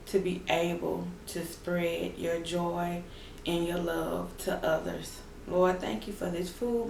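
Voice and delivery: soft, hushed prayer voice. Acted. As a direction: pray quietly and earnestly. Leads a prayer.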